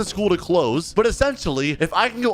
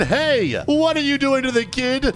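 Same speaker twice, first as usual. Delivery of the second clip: put-on whiny bully kid; 6 semitones higher than usual. Bully whines to principal.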